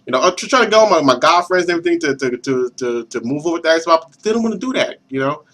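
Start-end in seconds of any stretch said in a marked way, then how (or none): none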